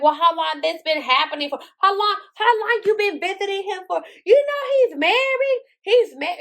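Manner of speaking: rising, high-pitched retold interrogation; indignant wife. Retold wife interrogating the other woman.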